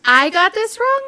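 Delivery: hammed-up wounded surprise; mock disbelief. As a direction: throw the accusation back, rising. Retold disbelieving answer to client.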